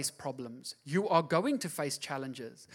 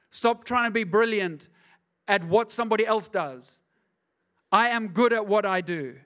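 ordinary talk, nothing marked

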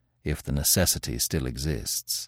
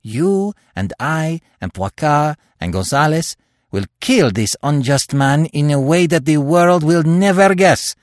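building from firm to a higher, louder, fervent pitch; carried away; 6 semitones higher, 4 dB louder than usual. A narrator's reading. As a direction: let the zeal run away with him. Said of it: "He stopped dead with flushed cheeks and kindling eyes".

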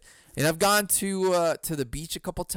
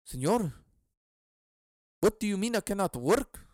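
hammed-up Mexican accent, higher voice; terrible Mexican accent; 4 semitones higher, faster than usual. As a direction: plead in a badly faked Mexican accent. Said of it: "that's a terrible accent".

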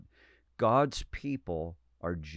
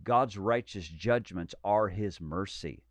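ordinary talk, nothing marked

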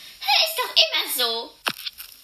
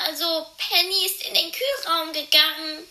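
ordinary talk, nothing marked

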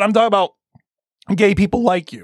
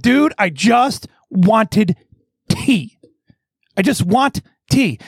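exasperated plea; 3 semitones higher, much slower than usual. Retold or imitated. Retelling his exasperated plea for tea.

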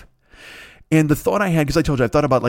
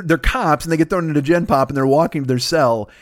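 normal talking voice

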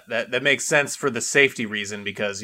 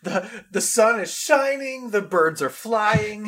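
slow, high, drawn-out rapture; mock bliss; 7 semitones higher, slower than usual. Friend teasing her sudden joy walking outside, slow mock bliss. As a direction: stretch each phrase in hammed-up wonder.

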